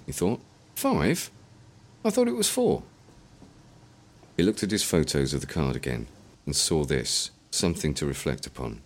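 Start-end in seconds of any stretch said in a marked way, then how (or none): 0.8-2.8 much higher, puzzled disbelief; catching the mistake; surprised realization. A narrator's reading. William reading the forum, surprised the code is five digits.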